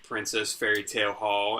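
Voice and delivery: ordinary talk, nothing marked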